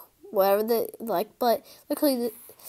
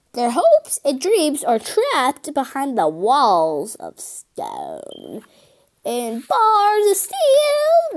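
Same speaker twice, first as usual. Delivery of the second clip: dramatic rallying speech; 4 dB louder, much slower than usual. Acted. Rousing mission speech in story.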